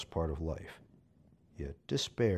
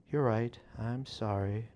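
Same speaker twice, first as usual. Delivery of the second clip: sad acceptance; 6 semitones higher, 3 dB louder than usual. A narrator's reading. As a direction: give in, heartbroken. Witch accepts the breakup.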